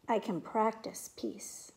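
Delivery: normal talking voice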